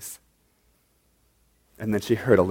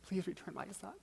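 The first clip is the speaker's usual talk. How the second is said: pleading; 7 semitones higher than usual. Acted. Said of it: "to her sister and pleaded".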